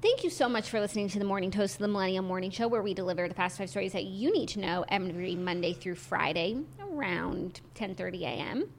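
fast, rattled-off show outro; podcast sign-off. Acted. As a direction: recite the well-rehearsed show wrap-up at speed.

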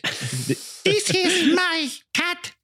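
very high, drawn-out caricature; Finnish cat owner. Acted. Finnish owner showing off the cat, slow high caricature. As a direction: slowly present it in a silly high voice. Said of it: "And he's like".

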